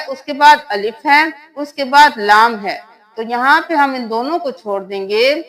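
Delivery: usual speaking voice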